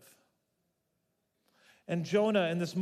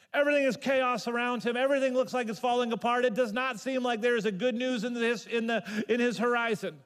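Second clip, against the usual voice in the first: loud, higher, driving sermon delivery; fervent preaching; 6 semitones higher, 4 dB louder than usual. Acted. Pastor describing Jonah's chaos to congregation, fervent and loud. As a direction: press the chaos home to the listeners.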